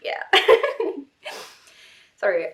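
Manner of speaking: ordinary talk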